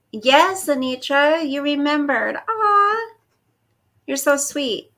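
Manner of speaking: high, crying aww of delight; touched and tender. Real, not acted. Host thanking Anitra for remembering, tender and high. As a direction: gush over the viewer, voice welling up. Viewer remembered her birthday.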